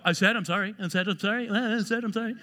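quick, repeated muttered sorry; defensive non-apology. Acted. Offender insisting they already apologized, defensive. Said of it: "when you have to say it like that".

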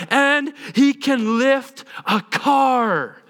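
high, slow, drawn-out brag; grand boast. Retold or imitated. Telling friends an impossible claim, grandly boasting. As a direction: stretch it into an outrageous announcement.

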